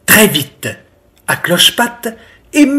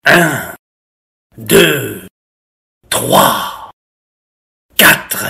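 slow count with spaces between numbers; wolf counting; 3 semitones lower, much slower than usual. A narrator's reading. Wolf counting for hide-and-seek, playfully menacing.

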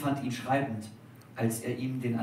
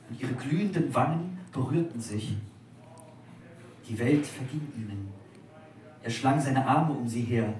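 ordinary delivery, nothing marked

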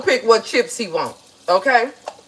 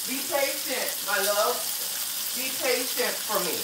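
soft, tender coaxing; softly soothing my love; 2 semitones higher, 10 dB quieter than usual. Acted. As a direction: hush it like a sweetheart, gently. Soothing the food lovingly.

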